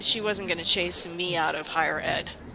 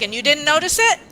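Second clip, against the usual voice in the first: higher, louder incredulous question; mock accusing boss; 8 semitones higher, 7 dB louder, faster than usual. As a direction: imitate her disbelief, pitched up and louder.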